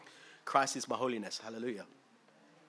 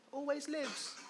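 much higher, much softer repeat; soft echo; 10 semitones higher, 8 dB quieter than usual. Acted. Preacher echoing the phrase, high and soft. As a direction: breathe it back gently.

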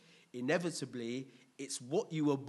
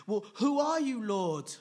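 much higher, loud, astonished cry; startled question; 8 semitones higher, 8 dB louder than usual. Retold or imitated. Paul retelling the crowd how he cried out to the Lord, startled and loud. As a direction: blurt it out, stunned by the light.

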